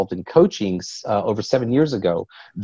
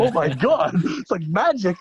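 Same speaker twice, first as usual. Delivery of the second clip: much higher, laughing through the amazement; excited laughing awe; 9 semitones higher than usual. Retold or imitated. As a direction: retells the thrill with a giggle. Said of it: "I just got really excited".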